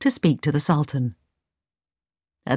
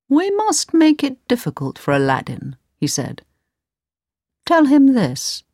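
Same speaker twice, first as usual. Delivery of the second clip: higher, sly plotting voice; scheming vizier; 8 semitones higher than usual. A narrator's reading. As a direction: plot it like a cunning adviser. Vizier plots against Aladdin.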